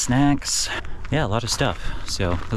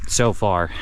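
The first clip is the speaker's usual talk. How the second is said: ordinary delivery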